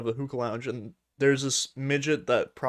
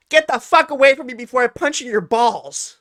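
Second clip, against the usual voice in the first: high, loud mock yell; hammed-up angry outburst, high and loud; retold furious threat; 11 semitones higher, 9 dB louder than usual. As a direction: shout her threat at him. Prostitute threatening Steve, retold.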